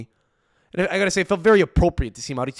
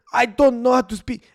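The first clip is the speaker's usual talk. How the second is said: mock Scottish-Italian accent, pitched much higher and louder; Scottish-Italian accent impression; 12 semitones higher, 10 dB louder than usual. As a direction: lampoon a commentator in a mixed Scottish and Italian accent. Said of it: "he speaks like, it's like Scottish and Italian".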